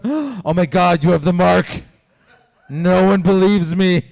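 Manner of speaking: loud, laughing gasped raccoon gossip. Pretend. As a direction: gasp like a scandalized raccoon. Raccoons meet in forest.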